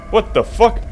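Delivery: high loud startled cry; startled player at a jumpscare; shocked gasp. Real, not acted.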